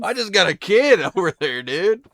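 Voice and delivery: loud, high bragging dude; excited bro. Pretend. Pretend winner bragging about the kid from the claw machine, loud high bro voice. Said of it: "it looked like you just won".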